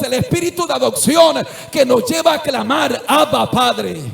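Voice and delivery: loud, rising sermon climax; preaching at full force. Acted. Preacher crying out his gratitude to the congregation, full force.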